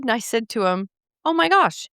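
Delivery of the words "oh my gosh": surprised. Retold or imitated. Retelling her surprised reaction.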